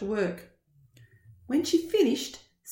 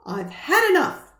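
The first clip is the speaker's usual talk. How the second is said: loud, exasperated mum; fed up; 6 semitones higher, 7 dB louder than usual. Acted. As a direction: snap it out, at your limit. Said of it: "said Mum".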